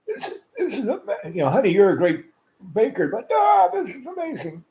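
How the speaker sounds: high, laughing, gushing praise with a cracking voice; amazed, laughing dad. Retold or imitated. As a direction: mimic dad bowled over by the cookies, laughing through it. Dad tastes the cookies, amazed.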